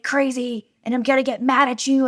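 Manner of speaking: high, loud, strained announcement; mock frantic parental outburst; near breaking point. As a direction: warn the kids you're about to lose it. Retold parent about to rage.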